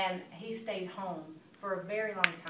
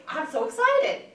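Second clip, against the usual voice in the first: high, loud, drawn-out gush; thrilled, stretched-out announcement; enthusiastic; 14 semitones higher, 12 dB louder than usual. Shown as a demonstration. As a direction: tease big news with slow excitement. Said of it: "the first one's enthusiasm".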